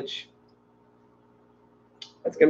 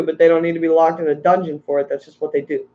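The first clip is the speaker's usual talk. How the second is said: ordinary talk, nothing marked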